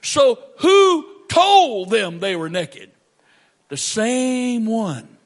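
loud, slow, high accusing question; loud and slow with weight; preaching at full force. Real, not acted. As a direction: thunder it at the congregation.

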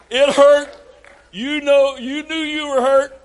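usual speaking voice

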